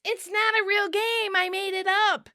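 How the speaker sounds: high-pitched acted shout; exasperated shout. A narrator's reading. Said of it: "Chandler shouts".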